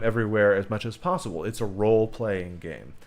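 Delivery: ordinary delivery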